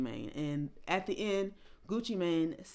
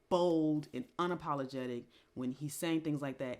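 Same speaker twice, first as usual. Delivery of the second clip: ordinary delivery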